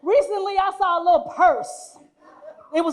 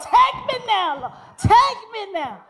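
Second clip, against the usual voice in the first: mock wailing; 7 semitones higher, slower than usual. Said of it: "weeping and wailing".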